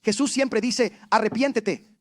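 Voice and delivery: loud, fervent preaching. Acted. Pastor preaching to the church, loud and fervent. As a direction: drive the message home to the congregation.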